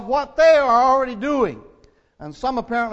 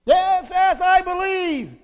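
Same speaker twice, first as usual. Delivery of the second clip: much higher, louder, pleading cry; desperate plea; 10 semitones higher, 5 dB louder, slower than usual. Acted. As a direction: beg for rescue, panicked. Tourist eagerly affirms belief.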